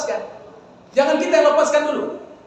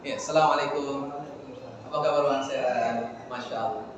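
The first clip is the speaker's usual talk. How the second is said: soft, much lower greeting with eye contact; salam yang nyambung; 10 semitones lower, 11 dB quieter than usual. Shown as a demonstration. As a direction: greet him gently, looking him in the eye. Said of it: "Ya, ini kan nyambung". (salam lembut dan hangat)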